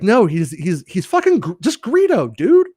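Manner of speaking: very high-pitched, incredulous protest; exasperated objection. Acted. Fan objects to a fancier name for Greedo, exasperated. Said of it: "It would be like".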